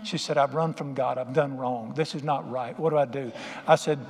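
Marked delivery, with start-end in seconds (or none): 0.4-3.3 low, subdued remorse, no real tears; retold sobbing confession. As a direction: quietly imitate a distraught daughter confessing. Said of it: "she's just sobbing".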